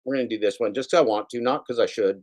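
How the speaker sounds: usual speaking voice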